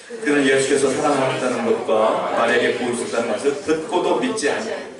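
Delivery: 낮은 목소리로 또박또박 성경 봉독; scripture reading. Acted. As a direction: read the verses low and measured, like a lector. (성경을 낮고 차분하게 낭독)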